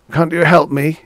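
high, loud appeal for help; pleading beggar. A narrator's reading. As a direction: plead with a passer-by.

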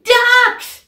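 high, loud shout; yelling. Acted. Michael yelling to Clancy, loud shout. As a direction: yell it down the phone. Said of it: "yelled Michael".

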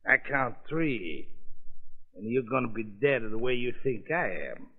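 put-on thick foreign-accented gangster, taunting; menacing mobster in broken English; lightly accented mob boss toying with the judge.

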